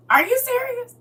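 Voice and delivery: jumps way up in pitch, stunned; can't believe what she just heard; high-pitched are-you-serious shock; shocked disbelief. Real, not acted. Hearing 52 with a six-year-old.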